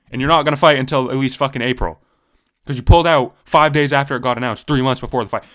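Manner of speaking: heated rant about the pulled-out fight. Real, not acted. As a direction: hammer the point home, heated. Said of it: "I got heated there for a second".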